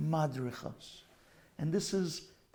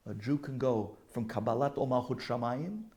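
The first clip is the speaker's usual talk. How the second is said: ordinary talk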